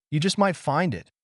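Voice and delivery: put-on English accent. A narrator's reading. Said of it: "the chef's English accent".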